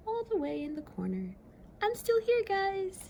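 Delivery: very high small voice; high tiny lonely voice; little Pluto. Acted. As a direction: call from the far corner.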